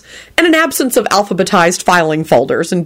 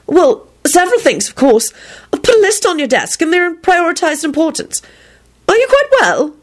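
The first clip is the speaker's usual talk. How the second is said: high, fussy secretary voice; worried secretary; 10 semitones higher than usual. A narrator's reading. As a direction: voice a prim, concerned assistant up high. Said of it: "feel a bit worried".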